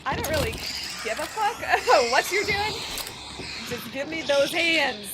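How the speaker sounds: loud, sassy pet demand, partly laughing; Gertrude the cat. Acted. Cat to her owner, wanting petting, loud sassy demand. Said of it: "she's like".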